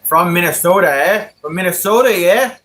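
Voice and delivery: put-on Minnesota accent. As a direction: playfully imitate a Minnesotan, stretching "Minnesota". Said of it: "What if I talk like that, B?".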